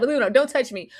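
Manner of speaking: ordinary talk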